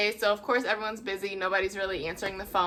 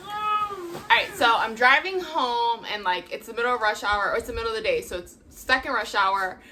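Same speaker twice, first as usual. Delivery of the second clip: normal talking voice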